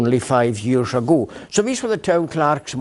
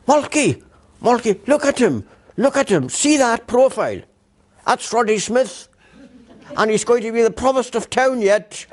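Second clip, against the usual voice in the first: high-pitched imitation of an excited hostess; Chrissy Dodo; 9 semitones higher than usual. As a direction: call your husband to look, much higher voice. Acts out Chrissy's excited prediction.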